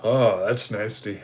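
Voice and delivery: normal talking voice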